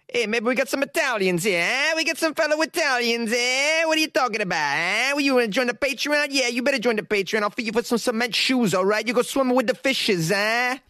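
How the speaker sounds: high-pitched cartoon Italian-American wiseguy, every line capped with "eh?"; mock Italian mobster. Mobster leaning on patrons to join, hammed-up Italian wiseguy. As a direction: ham up a pushy Italian mobster voice.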